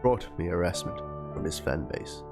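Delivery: ordinary delivery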